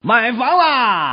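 high, long drawn-out cry; calling out. Acted. Performer opening the house-buying skit, high drawn-out call. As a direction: stretch it out like an announcement.

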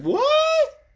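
very high, loud yelped reaction; exaggerated shriek of disbelief; acted astonishment. Retelling a shocked look at a TV witch, hammed-up astonishment.

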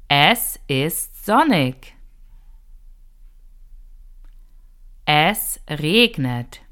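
slow, clear teacher model with pauses; slow for repeating. Shown as a demonstration. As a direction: give each phrase slowly so learners can copy. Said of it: "say the words again slowly".

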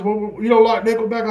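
high-pitched, affronted challenge; indignant drunk friend. Retold or imitated. Drunk fan challenging his friend, high indignant voice. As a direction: throw it out as an offended question.

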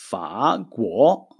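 פא גו said very slowly with falling tones; slow Chinese demo. Shown as a demonstration. Teacher modeling Chinese for the students, slow. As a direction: pronounce פא גו slowly, tone by tone. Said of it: "מילה הבאה".

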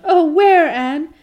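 high, loud acted wail; Carrie Sloane, sobbing. A narrator's reading. Carrie Sloane to injured Anne, acted sob. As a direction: wail the question, hammed-up. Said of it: "sobbed Carrie Sloane".

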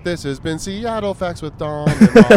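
slow, loud radio host; announcer voice. Pretend. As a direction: sign off like a show host. Mock podcast sign-off.

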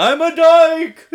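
very high, drawn-out loud whale wail; whale impression. Contestant doing a whale, wailing whale song speech. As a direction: stretch each word into a huge whale call.

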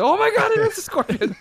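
very high, loud mock shriek; hammed-up cry of discovery, half laughing; shocked. Retelling both realizing the string is a scorpion, shocked.

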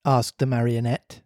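ordinary delivery

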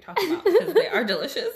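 fast, loud, high laughing protest; laughing insistence. Real, not acted. Insisting rosé is delicious, laughing.

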